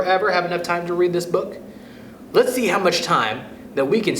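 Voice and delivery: plain reading voice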